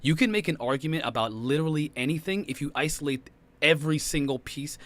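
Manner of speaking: ordinary talk, nothing marked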